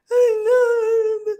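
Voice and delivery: high, loud crying wail; mock crying. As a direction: wail it through tears. Her crying reply to dad.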